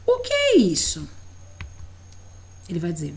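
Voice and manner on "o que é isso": bem mais aguda, como quem pergunta a uma criança; pergunta animada de professora. Shown as a demonstration. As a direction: pergunte alto e agudo, com entusiasmo. (professora pergunta ao aluno, aguda e animada)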